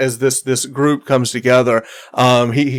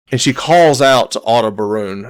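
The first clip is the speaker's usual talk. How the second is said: ordinary delivery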